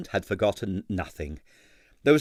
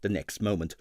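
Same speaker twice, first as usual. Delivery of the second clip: ordinary delivery, nothing marked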